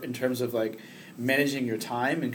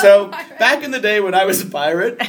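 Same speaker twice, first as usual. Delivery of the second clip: laughing; 8 semitones higher, 12 dB louder than usual. Real, not acted. Jokes about being a pirate.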